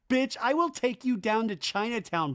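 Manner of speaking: hammed-up threat to a friend; mock threat. [name] threatening to take Brock down, mock menace.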